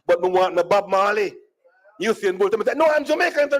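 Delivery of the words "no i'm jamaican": raised, high-pitched insistence; insistent protest. Retold or imitated. Comedian insisting to a doubting stranger, insistent protest. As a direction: protest your identity, pitched up. Protesting in an argument over nationality.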